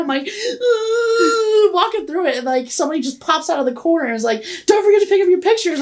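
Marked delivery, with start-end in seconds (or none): 0.3-1.7 high drawn-out wail; freaked-out wail. Retold or imitated. Retelling the scary mirror hallway, freaked-out high wail. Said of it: "I'm, like, freaking out". 4.7-5.8 high, quick put-on attendant voice; chirpy staffer impression. Lady surprising a high aquarium visitor, high quick call.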